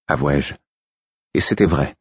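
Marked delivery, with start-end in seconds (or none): none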